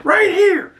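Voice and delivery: high, loud put-on voice calling stop; squeaky comic shout to stop the car; mock Deadpool. Imitating the teen telling the driver to stop.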